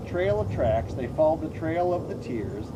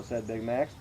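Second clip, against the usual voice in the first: ordinary delivery, nothing marked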